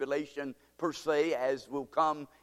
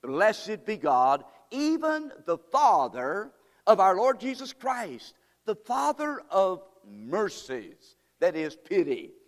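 preaching scripture; 3 semitones higher, 5 dB louder, slower than usual. Acted. Preacher reading to the congregation, loud slow fervour. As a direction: call it out with fervour. Reads verse three, preaching.

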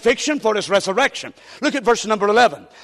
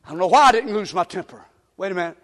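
ordinary talk, nothing marked

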